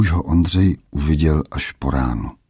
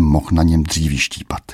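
plain reading voice

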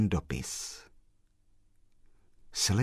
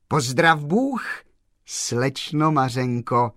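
slow, raised greeting call; 5 semitones higher, 6 dB louder, slower than usual. A narrator's reading. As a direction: call a drawn-out, higher greeting, mildly loud. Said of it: "zavolal pan Kolbaba". (protáhle zavolat na pozdrav)

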